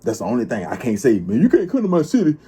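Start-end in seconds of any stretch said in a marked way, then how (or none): 1.2-2.4 higher, louder tough-guy threat; mock the fake gangster bluster; hammed-up tough-guy warning; fake tough talk. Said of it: "I can't talk like that".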